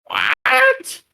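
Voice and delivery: squeaky high, confused cry; a doddering kobold who can't hear; old kobold sorcerer answering the dragon in confusion. Acted. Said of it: "approaches the dragon, like".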